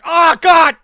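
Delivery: very loud, high cry out; pained yell. Retold or imitated. Reenacting pain whenever the shin was touched, pained yell. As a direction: yell as if struck on a sore spot.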